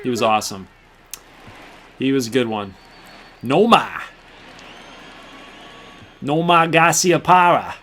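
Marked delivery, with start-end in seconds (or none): 3.4-7.7 Boston accent. Shown as a demonstration. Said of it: "Say Nomar in a Boston accent".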